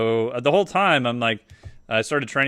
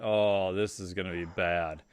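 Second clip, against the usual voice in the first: low, quiet retold groan of realization; understated dread, dropping low and soft; sinking dread; 4 semitones lower, 7 dB quieter, slower than usual. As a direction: say it under your breath, resigned. Acted dread at equations on board.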